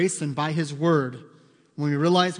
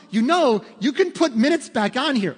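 exasperated telling; 8 semitones higher, 6 dB louder than usual. Retold or imitated. Exasperated advice to stepdad, retold.

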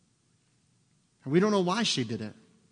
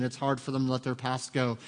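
ordinary delivery

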